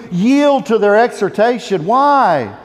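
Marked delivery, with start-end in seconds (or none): none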